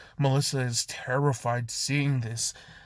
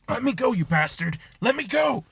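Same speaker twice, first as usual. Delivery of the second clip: high, loud yell to be released; angry shout; 10 semitones higher, 4 dB louder than usual. Acted. Melissa held in the air, yelling at Izuku, angry shout. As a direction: shout it high, struggling to break free.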